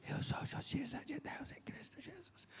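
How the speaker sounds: barely voiced, hushed whisper. Young man repeating the pastor's words, timidly whispered. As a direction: mouth the words almost without voice. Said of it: "Mais alto".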